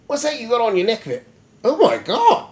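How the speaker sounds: high, quick put-on monkey voice; curious monkey man. Monkey Man spotting the necklace, in a high, astonished creature voice. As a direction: pitch it up, nosy and astonished.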